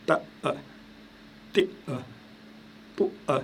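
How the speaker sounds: ta, te, pou each cut short, then a tiny euh released; syllable, throat catch, small euh after ta, te and pou; glottal stop demo. Shown as a demonstration. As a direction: add a little detached euh after each chopped ta, te, pou. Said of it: "C'est comme si vous mettiez un petit E".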